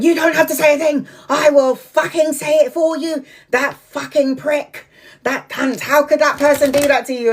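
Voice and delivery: high, sweary outrage on a friend's behalf; indignant outburst. Retold or imitated. Speaking up for the calm friend, indignant. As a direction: re-enact being furious for a friend. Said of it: "then she's all there really calm".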